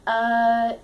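usual speaking voice

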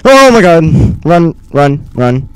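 loud panicked shout; panicked yell. Real, not acted. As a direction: yell to run in fear. Slender appears, fleeing.

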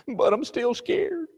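put-on high, slow little girl's whimper. Daughter insists to her father, high and slow. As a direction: drag it out like a frightened child. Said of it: "what if she says".

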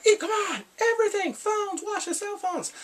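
high, pushy demand voice; high mock command for the phones; mock bossy guard. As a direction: squeak out the orders like a cartoon goon. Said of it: "and then they're like".

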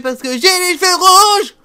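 hammed-up brazen voice climbing to a squeal; put-on diva voice. Shown as a demonstration. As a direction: build to a high, loud, exaggerated finish. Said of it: "le droit de faire une voix de pute".

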